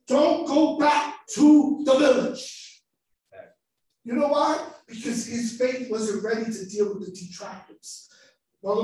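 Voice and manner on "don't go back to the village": slow, loud, weighty command; Jesus speaking. Acted. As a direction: deliver each word as a solemn warning. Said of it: "And then Jesus says".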